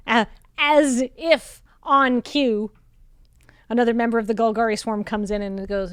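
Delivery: ordinary talk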